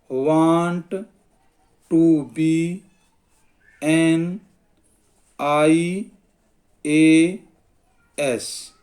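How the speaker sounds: word by word, long pauses between; slow dictation. Shown as a demonstration. Teacher dictating an example sentence to students, slow dictation. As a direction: dictate it slowly for writing down.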